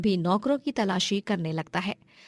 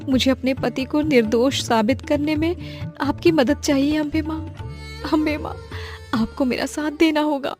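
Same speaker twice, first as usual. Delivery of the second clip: earnest prayer; 6 semitones higher, 5 dB louder than usual. Acted. Praying to goddess.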